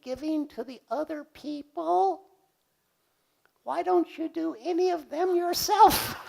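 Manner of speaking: very high, slow, squeaky little voice; piping inner-critic voice, teasing. Retold or imitated. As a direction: play the tiny nagging voice in the back of your mind. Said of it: "a small voice going".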